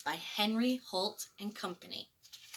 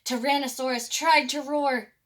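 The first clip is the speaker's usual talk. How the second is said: ordinary delivery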